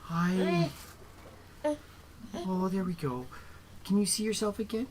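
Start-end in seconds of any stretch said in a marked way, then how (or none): none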